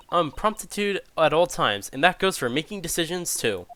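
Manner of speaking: normal talking voice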